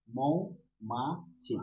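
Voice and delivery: মৌমাছি spoken extremely slowly, each syllable pulled apart; slow syllable demo. Shown as a demonstration. Teacher repeats মৌমাছি for pupils, stretched very slowly. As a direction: draw out মৌমাছি syllable by syllable for the class. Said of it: "কি উচ্চারণ করছি?".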